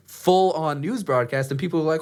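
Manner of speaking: usual speaking voice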